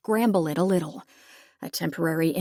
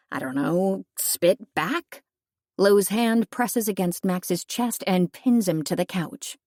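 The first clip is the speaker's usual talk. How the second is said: usual speaking voice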